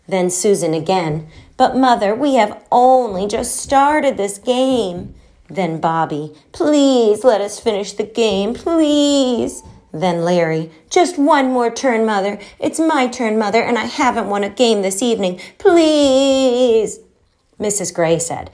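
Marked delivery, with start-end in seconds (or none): 1.6-5.1 put-on higher, slow, drawn-out child's whine; a little girl begging to finish the game; pleading child. A narrator's reading. Child begging to keep playing. 6.5-9.6 put-on higher, slowed child's plea rising on the last please; a boy begging his mother for more time. A narrator's reading. Child begging to finish game. 10.9-17.0 put-on a boy begging his mother for one more turn; whining child. A narrator's reading.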